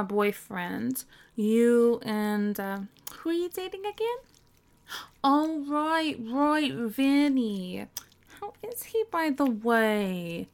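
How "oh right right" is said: normal talking voice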